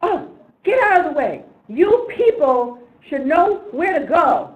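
loud, high, drawn-out angry order; indignant mock shout, stretching the words; retold yell. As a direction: yell it like an outraged passenger. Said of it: "he yells at me".

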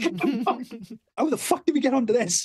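high-pitched, laughing incredulity; baffled disbelief. Retold or imitated. Son reacting to dad's tangent, baffled disbelief. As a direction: retell it bewildered, laughter breaking in.

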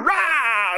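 high game-day roar; acted roar of a charging player; hammed-up rah. Player bursting through the cheerleaders' paper, hammed-up roar. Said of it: "you run through the paper".

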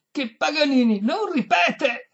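high, loud, slow insistence; exasperated, projected refusal; emphatic shout. A narrator's reading. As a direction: hammer each word for a deaf listener. Exasperated repetition to deaf lady.

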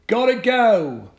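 put-on high, loud boy with a touch of regret; regretful farewell. Paperboy says goodbye to Bennett with a hint of regret. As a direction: leave with wise reluctance. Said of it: "a hint of sagacious regret".

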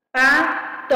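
"Pato" broken into two firm beats, pa-to; syllable by syllable. Shown as a demonstration. Teacher counting out two syllables for pupils, syllable by syllable. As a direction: split "Pato" into separate syllables with a gap. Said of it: "Duas sílabas".

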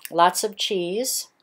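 ordinary talk, nothing marked

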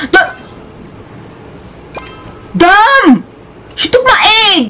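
high-pitched outraged yell. Real, not acted. Player whose egg was just stolen, outraged. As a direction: wail in outrage.

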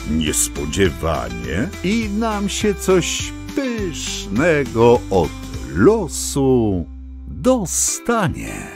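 ordinary delivery

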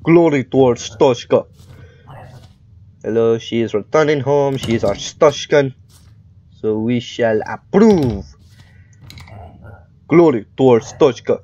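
mock Russian border official accent; terrible Russian accent. Inspector approving a returning citizen, terrible Russian accent. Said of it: "my terrible Russian accent".

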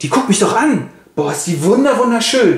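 lightly voiced dazzled youth; awestruck. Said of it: "ist voll geflasht".

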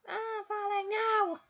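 high, drawn-out wail; stretched, high-pitched dismay; falling cry. Real, not acted. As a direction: cry out as your game character drops. Falling in the game, calls out.

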